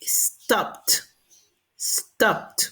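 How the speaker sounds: "stopped" in one syllable, crisp "pt" at the end; T ending demo. Shown as a demonstration. Teacher applies the rule for students, "stopped" ending in T. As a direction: release a T straight after the P of "stopped". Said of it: "la pronunciación E D va a ser en T".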